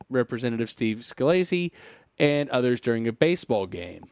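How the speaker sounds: normal talking voice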